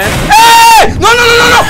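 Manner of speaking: very high, loud shriek then panicked nos; terrified scream. Real, not acted. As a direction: scream as the monster jumps you. Jump scare, screaming. (grito de terror)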